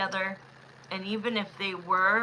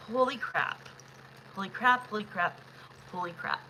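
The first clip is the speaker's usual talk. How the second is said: repeated exclamation, dying from normal to softer; excited holy crap fading out; 5 dB quieter than usual. Real, not acted. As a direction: say it again and again, trailing off in awe. Reacting to a message.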